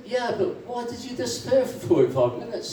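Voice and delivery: high-pitched puzzled questioning; puzzled bar owner. Acted. Bar owner asking about the absence, puzzled.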